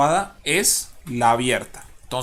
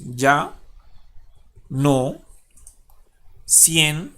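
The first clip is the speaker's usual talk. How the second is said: "ya no siento" slowly, with long pauses between syllables; much slower than usual. Shown as a demonstration. Teacher splitting the last verse, "ya no siento" in slow separate syllables. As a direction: dictate "ya no siento" one isolated syllable at a time. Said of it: "vamos acá a dividir".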